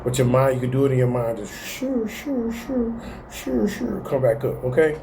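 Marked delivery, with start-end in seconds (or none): 1.7-4.0 high, soft shooing hiss; whispered high shoo; soft shoo sounds. Shown as a demonstration. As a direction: sweep down the body with a hush. Demonstrating scan with sounds.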